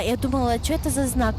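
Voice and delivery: ordinary delivery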